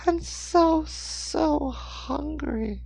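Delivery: put-on very high, drawn-out child voice; scared little boy. A narrator's reading. Hungry boy to the older man, drawn-out pleading boy. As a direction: stretch it slowly in a small boy's pitch. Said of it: "he whispered".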